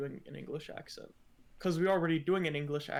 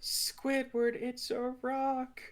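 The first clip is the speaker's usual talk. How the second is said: high, slow cartoon sponge voice; pitched-up, drawn-out cartoon voice; SpongeBob impression; 9 semitones higher, slower than usual. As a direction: quote SpongeBob, slow and squeaky. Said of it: "it's from SpongeBob".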